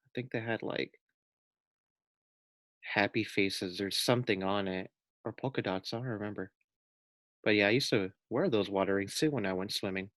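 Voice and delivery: soft, fading remembering; quiet aside. Real, not acted. Teacher remembering her own water wings, hushed. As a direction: drop to a murmured recollection.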